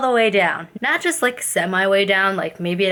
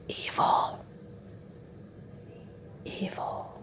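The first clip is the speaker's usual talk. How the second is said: whisper; 16 dB quieter, slower than usual. Acted. Whispered joke about nails.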